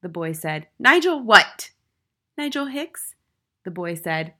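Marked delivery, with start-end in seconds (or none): 0.8-1.7 loud, high headmistress shout; angry bellow, falling at the end; bellowed demand. A narrator's reading. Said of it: "the Trunchbull bellowed". 2.4-3.1 put-on high little-boy voice, rising; high, tentative schoolboy; small brave boy. A narrator's reading.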